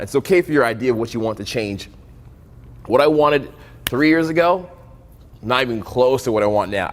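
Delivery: ordinary talk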